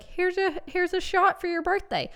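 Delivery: high, loud offer of a shot; party guest. Retold or imitated. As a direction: hand over a shot cheerfully.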